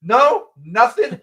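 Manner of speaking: high, loud one-word questions; mock incredulous prodding. Podcaster pressing Jamie who stays silent, high mock incredulity. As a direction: poke for an answer, voice way up.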